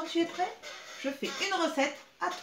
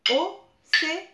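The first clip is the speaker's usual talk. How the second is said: Océane loud and higher, O-cé-a-ne; syllable by syllable; 6 semitones higher, 9 dB louder, slower than usual. Shown as a demonstration. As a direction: clap out Océane in four beats. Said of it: "quatre syllabes pour son prénom". (Océane scandé, fort)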